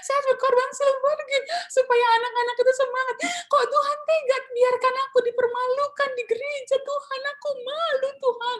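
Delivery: high, loud crying lament; sobbing complaint. Retold or imitated. Humiliated choir teacher at home complaining to God: loud sobbing lament. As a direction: wail your grievance to God through sobs. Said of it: "saya menangis, benar-benar menangis".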